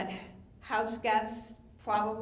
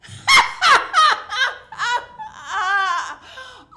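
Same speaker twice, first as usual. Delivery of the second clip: big scornful burst of laughter, much higher and louder; loud mocking laugh; 14 semitones higher, 15 dB louder than usual. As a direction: laugh right in her face. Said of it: "she's laughing at".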